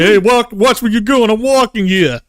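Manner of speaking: put-on a British Brooklyn accent, pushy; Brooklyn-British passerby accent. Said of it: "In a British Brooklyn way".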